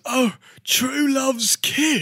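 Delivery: high, slow over-dramatic sigh; dramatic awakening. Acted. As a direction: wake up like a sleepy baby, hammed-up. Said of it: "he goes".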